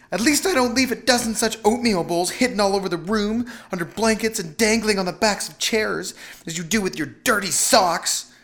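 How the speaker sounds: high-pitched scornful wife; high, indignant comeback; scornful retort. A narrator's reading. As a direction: fire back scornfully in a high voice. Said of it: "she retorted quite scornfully".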